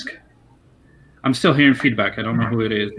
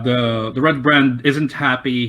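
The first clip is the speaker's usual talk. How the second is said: normal talking voice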